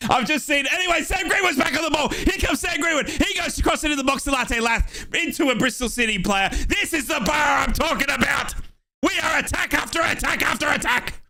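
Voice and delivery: fast, high, shouted call; excited shouting. Real, not acted. Fan commentator hyped by the attacks, high shouts. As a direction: ramp it up with the attack.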